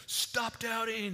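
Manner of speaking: mock yell. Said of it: "like he would just yell".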